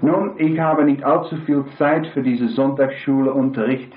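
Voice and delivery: ordinary talk, nothing marked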